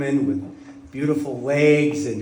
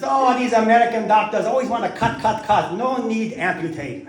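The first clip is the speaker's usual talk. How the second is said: Chinese doctor; 6 semitones higher than usual. Retold or imitated. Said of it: "He says".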